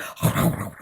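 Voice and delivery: imitated noisy wolfing down of food; mock gobbling noise. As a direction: imitate someone devouring a meal. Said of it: "this dude just goes to town, just devours tuna".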